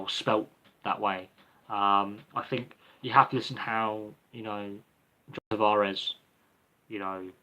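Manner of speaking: ordinary talk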